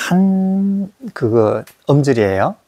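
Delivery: usual speaking voice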